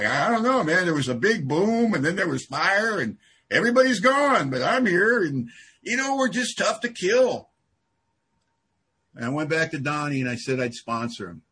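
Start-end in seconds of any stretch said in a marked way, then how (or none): 0.0-7.5 mock bewildered survivor. Plays lone survivor after the bomb.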